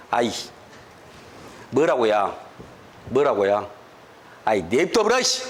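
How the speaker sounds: gruff Jeolla accent, low and curt, mid-argument; rough Jeolla dialect quarrel. Shown as a demonstration. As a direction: snap back in Jeolla dialect like a fed-up rival. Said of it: "전라도는 이렇게 표현을 했어요".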